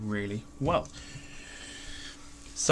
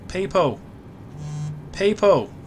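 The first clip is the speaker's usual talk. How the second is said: calling out; 4 semitones higher, 6 dB louder, slower than usual. Real, not acted. Calling out to viewers.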